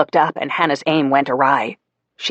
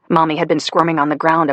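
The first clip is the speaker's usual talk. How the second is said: ordinary delivery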